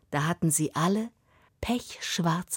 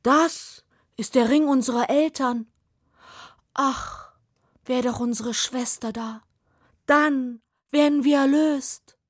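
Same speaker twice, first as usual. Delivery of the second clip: higher, louder longing raven voice; ravens lamenting; 7 semitones higher, 4 dB louder than usual. Acted. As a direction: sigh for the lost sister. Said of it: "riefen alle Raben zugleich".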